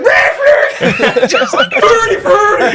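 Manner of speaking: high, shouted laughing gibberish; furious puppet blurts, over and over; the chef raging at the judges; pissed Swedish Chef. Retold or imitated. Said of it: "Just legitimately pissed".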